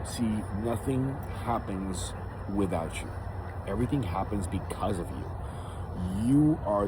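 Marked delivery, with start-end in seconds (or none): none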